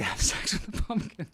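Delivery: breaking into a laugh mid-sentence. Real, not acted. Comedian on the silly pumpkin film, laughing. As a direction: let real laughter creep into the words.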